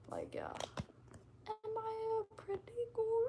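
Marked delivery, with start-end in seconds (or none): 1.5-3.3 put-on very high, slow girly voice; hammed-up squeaky little-girl act; mock girly girl. Streamer mocking chat's idea of a girl, high girly voice.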